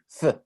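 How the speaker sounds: "Z" said with a th sound, tongue between the teeth, as in Spain; Spain-style z. Shown as a demonstration. Teacher showing the class how people from Spain say "Z", lisped th sound. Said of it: "pronuncian la Z como así".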